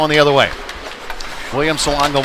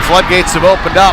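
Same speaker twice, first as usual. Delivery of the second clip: excited goal follow-up; 4 semitones higher, 7 dB louder than usual. Real, not acted. After goal, loud higher call.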